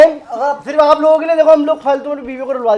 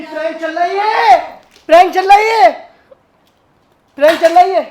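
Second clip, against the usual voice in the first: prank reveal shout; 3 semitones higher, 4 dB louder than usual. Real, not acted. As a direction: call it out over the commotion. (ज़ोर से खींचकर ऐलान करते हुए)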